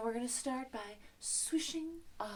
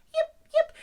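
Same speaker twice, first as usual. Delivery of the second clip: sharp, very high loud yips; fox yip; 13 dB louder than usual. Shown as a demonstration. Teacher answers the song as a fox, fox yip. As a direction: yelp like an excited fox. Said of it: "a fox makes a sound like this".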